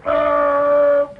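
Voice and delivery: a loud startled Oh; surprised oh. Real, not acted. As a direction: gasp out Oh. in surprise. Sudden surprised exclamation.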